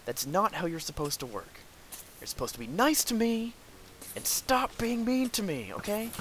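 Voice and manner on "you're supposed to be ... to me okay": whiny pleading. Real, not acted. Scolding game mob childishly.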